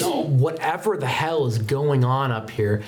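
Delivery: ordinary talk, nothing marked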